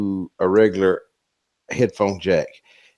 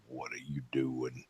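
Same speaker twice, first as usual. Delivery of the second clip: much lower and softer, slowly; super deep voice; 9 semitones lower, 20 dB quieter than usual. Shown as a demonstration. He sneaks up on his wife, super deep slow voice. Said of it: "let's walk up and go".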